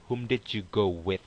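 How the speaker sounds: slow, careful model sentence with every word clear; correct model sentence. Shown as a demonstration. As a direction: spell out "Whom did you go with?" slowly, like a teacher. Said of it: "Tanyanya seperti ini".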